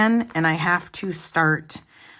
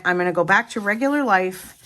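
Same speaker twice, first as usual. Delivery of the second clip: ordinary talk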